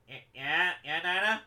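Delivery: lightly put-on cartoon sidekick voice; Cosmo. Cosmo answers Dinah's call, lightly put-on character voice. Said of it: "this is my Cosmo voice".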